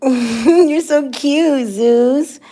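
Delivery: high, slow cooing to a pet. Real, not acted. Talking sweetly to her dog.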